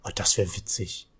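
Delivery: breathy amused whisper. Real, not acted. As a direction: whisper it under your breath. Sudden whispered aside. (geflüstert)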